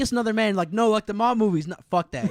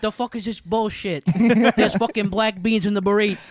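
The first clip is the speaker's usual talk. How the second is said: mock mobster, rising to a loud outburst; Mexican Tony Soprano voice; 2 semitones higher, 5 dB louder than usual. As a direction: gripe at the burrito like a Jersey mobster. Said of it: "Mexican Tony Soprano".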